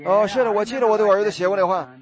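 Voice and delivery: usual speaking voice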